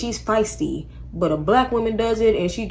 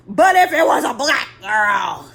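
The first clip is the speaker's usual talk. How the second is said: usual speaking voice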